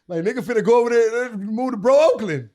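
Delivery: loud, high "Bro Oakland" with stretched O; mock mispronunciation. Joking with friends about Brooklyn, loud mock "Bro Oakland". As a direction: blare "Bro Oakland" with a long O.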